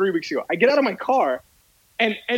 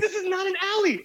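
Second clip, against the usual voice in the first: outraged; 12 semitones higher than usual. Retold or imitated. Arguing with the boss.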